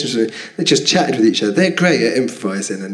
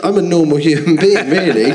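ordinary talk, nothing marked

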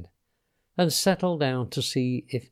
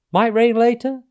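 high, loud, questioning character voice; high-pitched cheery storybook youngster; chatty small talk; 9 semitones higher, 6 dB louder than usual. A narrator's reading. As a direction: pipe up hopefully about the weather. Pateri's small talk to dinosaur.

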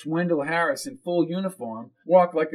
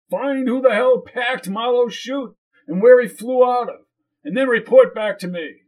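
commanding; 9 semitones higher, 6 dB louder than usual. Acted. Boss barks orders at Jones.

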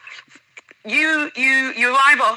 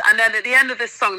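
normal talking voice

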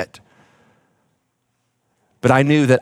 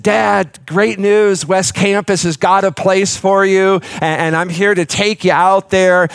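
raised, eager voice bringing good news; upbeat good news; 7 semitones higher than usual. Retold or imitated. Son telling his sick father about West Campus, eager and raised. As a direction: sell it brightly, voice lifted high.

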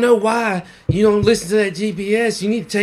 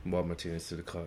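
usual speaking voice

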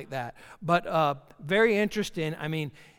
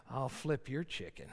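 suggestive innuendo; 5 semitones lower, 10 dB quieter than usual. Retold or imitated. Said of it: "And I'm like".

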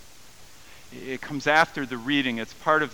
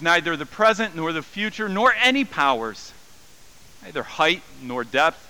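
firm, slightly louder reading; scripture reading; 3 semitones higher, 4 dB louder than usual. Acted. Pastor reading Romans eight, firm declaration. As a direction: read it as a declaration.

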